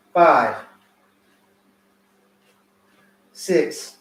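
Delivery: loud count, short punchy calls; boxing coach calling combos. Acted. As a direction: call each combo like a coach.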